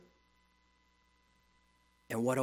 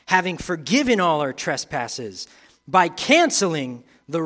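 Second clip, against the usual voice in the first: louder, ringing preacher's proclamation; full-force preaching; 4 semitones higher, 11 dB louder than usual. Acted. Preacher proclaiming forgiveness to the congregation at full force. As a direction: proclaim it over the whole room.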